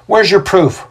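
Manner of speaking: high-pitched challenge; demanding magistrate. A narrator's reading. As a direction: press the accuser for an answer.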